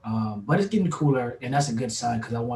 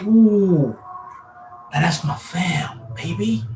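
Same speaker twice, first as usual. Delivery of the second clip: excited praise; 5 semitones higher, 4 dB louder, much slower than usual. Retold or imitated. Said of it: "I was like".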